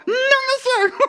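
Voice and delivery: put-on very high, childlike creature voice; little dog character. Little dog answering the host, high creature voice. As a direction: squeak it politely, very high.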